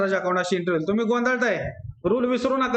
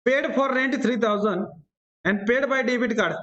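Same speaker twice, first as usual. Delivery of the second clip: slow and spaced out; slow dictation; much slower than usual. Shown as a demonstration. Teacher dictating a rent problem to students, slowly. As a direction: read it out so they can write.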